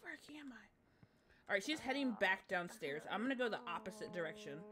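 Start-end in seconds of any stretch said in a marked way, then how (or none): 0.0-1.5 slow hushed whisper. Real, not acted. Streamer lost and hiding in the house, slow whisper. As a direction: whisper it so she won't hear. Sneaking past the monster.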